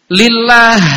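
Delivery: ordinary talk, nothing marked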